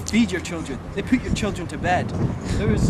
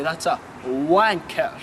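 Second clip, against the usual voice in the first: slow, drawn-out local way of saying that's a wanker; slowed Scottish-local delivery; local Elgin slang; 5 dB louder, much slower than usual. Shown as a demonstration. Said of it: "You'd say".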